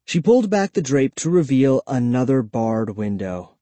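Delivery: ordinary delivery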